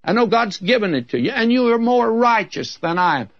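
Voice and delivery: lightly voiced Saul admitting defeat; Saul conceding. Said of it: "he said".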